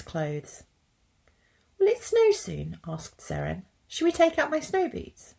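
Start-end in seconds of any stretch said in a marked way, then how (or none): 1.8-2.8 put-on high, eager little-girl voice; high child voice full of hope; little Seren. A narrator's reading. Child character Seren asks. 3.9-5.3 put-on high, eager little-girl voice; high child voice full of hope; little Seren. A narrator's reading. Child character Seren asks.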